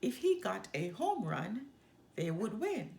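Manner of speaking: ordinary delivery, nothing marked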